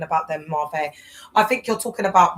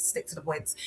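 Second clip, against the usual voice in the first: "stick to the points" dropped much softer, almost to herself; softer repeat; 11 dB quieter than usual. Real, not acted. To her live viewers, reminding herself, murmured much softer. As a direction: say "stick to the points" again under your breath. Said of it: "if I'm speaking like this".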